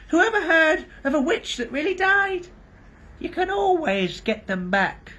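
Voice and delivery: put-on high, slow whining old crone; old hag flatters the doctor; shrill whining hag. A narrator's reading. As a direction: wheedle like a fawning witch. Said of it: "the shrill whining voice began".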